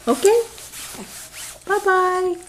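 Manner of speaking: high bright bye-bye. Real, not acted. Mother waving bye-bye to her baby, bright singsong.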